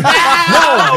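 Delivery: high, loud laughing cry of no; mock refusal. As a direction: blurt out an over-the-top denial. Acted disbelief at being told truth.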